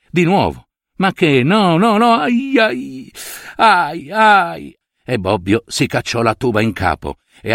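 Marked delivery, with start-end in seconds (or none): 1.0-4.8 pained cries. Acted. Toothache returns sharply.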